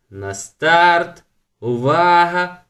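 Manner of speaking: loud, drawn-out starter's call; race start call. Acted. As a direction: call the start loudly and slowly. (гучно, протяжно, як стартер)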